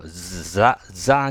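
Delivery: "ザ" with a loud buzzing Z in place of TH; Japanese Z for TH. Shown as a demonstration. Teacher imitating learners' mistake, buzzy Z instead of TH. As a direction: buzz a hard katakana "ザ". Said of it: "ザになっちゃう方が多い".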